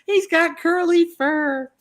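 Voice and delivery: very high, slow gushing squeal; mock squealing stranger gushing over fur; squealing admirers. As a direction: coo over the pig like a delighted fan. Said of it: "people were screaming".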